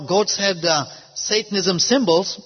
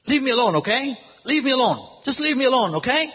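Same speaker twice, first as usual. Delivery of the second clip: higher-pitched, mildly fearful plea; retold fear; 6 semitones higher, faster than usual. Frightened man to the pastor who urges him, imitated fearful protest. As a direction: imitate a scared man fending someone off. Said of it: "looked at me with fear".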